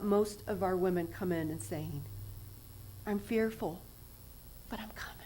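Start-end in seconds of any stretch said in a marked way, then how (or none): 3.1-5.1 hushed, fearful admission; whispered confession. Acted. Recovering women confessing to Jesus, whispered. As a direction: whisper it like a secret shame.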